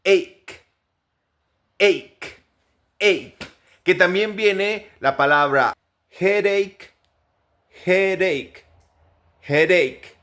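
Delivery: ache ending in a hard k; k sound in ache. Shown as a demonstration. Teacher drilling words where ch sounds like k. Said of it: "es el de una K".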